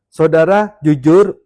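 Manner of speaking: ordinary delivery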